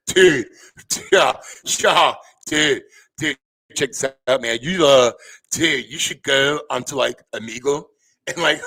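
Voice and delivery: a lightly voiced laid-back dude; imitating Philly Sports Fan. Streamer mimicking the fan who messaged him, lightly voiced dude. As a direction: mimic a chill bro pitching an idea. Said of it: "this is exactly how he sounded".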